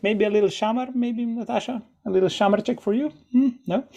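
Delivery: teasing. Retold or imitated. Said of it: "I'm always just like".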